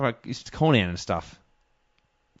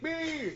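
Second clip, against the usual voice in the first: high, softer "beee" squeal; imitated squeal; 14 dB quieter than usual. Host mimicking the wrestler hitting a move, high squeal. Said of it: "he went".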